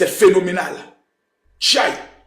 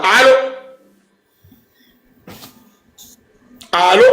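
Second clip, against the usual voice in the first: high, rising hello into a bad line; calling hello; 4 semitones higher than usual. Real, not acted. Host checking for a caller he can't hear, calling hello. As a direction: call out, pitch lifting sharply at the end.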